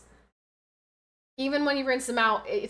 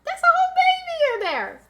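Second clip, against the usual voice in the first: emotional squeal; 4 dB louder than usual. Real, not acted. Awed at ultrasound, repeats.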